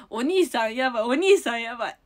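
loud, high burst of amusement; laughing exclamation. Real, not acted. Streamer reacting to guesses about the visitor, laughing exclamation. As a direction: blurt it out while cracking up.

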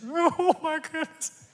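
high, slow, whiny mock sob; hammed-up weepy giver; mock crying. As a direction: pretend to whimper over the check. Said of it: "If you're crying as you cut your che".

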